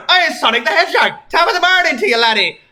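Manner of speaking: loud, high, fast British bark, laughing through it; hammed-up cheery British bark. Tracer greets Sonic over morning papers, hammed-up laughing British bark. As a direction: overdo the jolly British neighbour, laughing as you go. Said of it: "barked Tracer in a cheery British voice".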